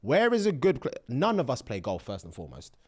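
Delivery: ordinary talk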